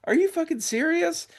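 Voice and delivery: high-pitched shocked question; can't believe what he just said; incredulous disbelief. Retold or imitated. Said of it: "And I was like".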